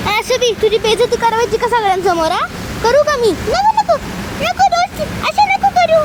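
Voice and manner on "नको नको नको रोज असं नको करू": put-on high, slow child voice begging; pleading child. Begging her mum not to shame her, a high pleading child. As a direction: whine no like a little girl.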